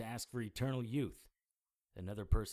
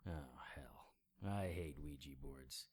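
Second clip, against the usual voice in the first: softer, whispered dismay; muttered whisper; 5 semitones lower, 8 dB quieter, slower than usual. Acted. Detective whispering dismay at seeing the Ouija board. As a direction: groan it under your breath.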